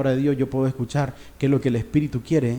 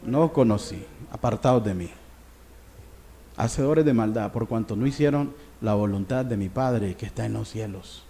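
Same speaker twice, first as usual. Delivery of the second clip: stern judge; 5 semitones lower, 4 dB quieter than usual. Acted. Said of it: "Y él les dirá".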